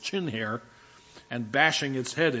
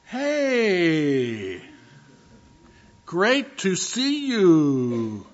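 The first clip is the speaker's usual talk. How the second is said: slow, higher, overdone welcome; fake friendly greeting; 4 semitones higher, 5 dB louder, much slower than usual. Guest who forgot your name, slow, overdone cheer. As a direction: stretch it out to hide a forgotten name. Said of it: "the person goes".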